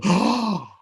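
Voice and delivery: high, loud gasping oh; crowd gasp. Retold or imitated. Crowd gasping as he walks out, high and loud. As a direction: gasp like a whole crowd seeing him. Crowd gasps at his entrance.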